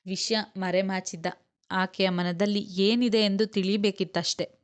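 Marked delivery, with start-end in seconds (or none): none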